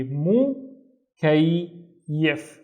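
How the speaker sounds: MU, pause, KAY, pause, YIF; syllable by syllable. Shown as a demonstration. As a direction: break "mu, kay, yif" into three separate beats. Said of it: "pronounce it once with this syllable".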